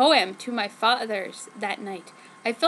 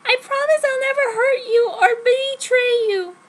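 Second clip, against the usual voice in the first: high, louder, overwrought pleading; the lovesick heroine begging to be trusted; breathless melodramatic girlfriend voice; dramatic teen heroine; 11 semitones higher, 7 dB louder than usual. Acted.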